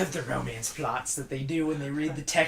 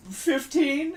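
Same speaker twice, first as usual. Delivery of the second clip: nervous high squeak; 5 dB louder, much slower than usual. Retold or imitated. Acting out a caught predator.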